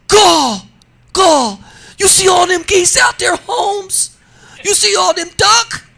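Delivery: high, loud imitation of a Louisiana relative marveling at the geese; Louisiana in-law voice. In-laws from Louisiana gawking at geese on the pond, loud high Louisiana accent. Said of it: "And they talk just like this".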